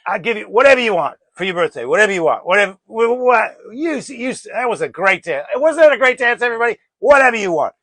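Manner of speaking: put-on loud, high, gushing royal drunk; effusive tipsy English-accented boasting; drunk English king. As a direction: play a hammered king announcing to the whole party. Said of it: "and a little bit drunk".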